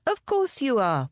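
chirpy mock reassurance; cheerful. A narrator's reading. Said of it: "Bobby said cheerfully".

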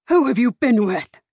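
loud, furious father; angry shout. A narrator's reading. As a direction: shout the accusation. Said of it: "he shouted".

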